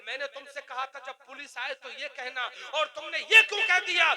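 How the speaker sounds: acted reprimand rising to a loud demand; retold angry challenge; threatening lawyer. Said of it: "client को धमकाते हुए".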